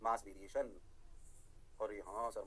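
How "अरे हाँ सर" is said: low, quiet student agreement; mock student reply. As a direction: answer softly as the class, pitched well down. Teacher voices the students' reply.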